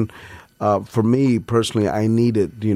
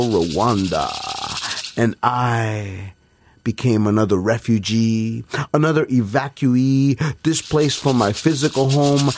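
dramatic bilingual poetry delivery; spoken word performance; 5 semitones higher than usual. Acted.

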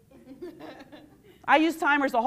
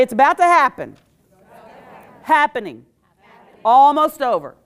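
loud chant for the crowd to repeat; loud call and response; 12 dB louder than usual. Shown as a demonstration. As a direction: lead the audience in a loud chant. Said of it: "repeat after me".

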